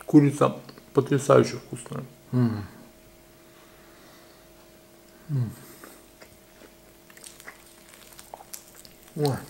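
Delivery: talking with mouth full; muffled between bites; chewing chicken. Real, not acted. Seen 2: Holds chicken wing, closed mouth working and cheeks bulging throughout.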